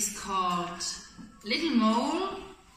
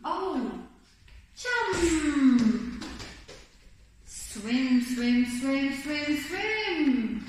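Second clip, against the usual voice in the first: excited; 5 semitones higher, much slower than usual. Acted. Bear jumping into lake.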